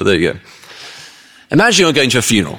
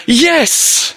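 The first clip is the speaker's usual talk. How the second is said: very high, exultant yell; triumphant cheer; 3 dB louder than usual. Acted. Late mourner at the casket, inappropriately jubilant. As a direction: let out a hammed-up victory cry. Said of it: "and he just goes".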